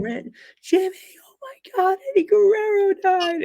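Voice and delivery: high, crying wail of grief; imitating a mother's sobbing cry. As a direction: wail the news through tears. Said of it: "I can hear his mom yelling".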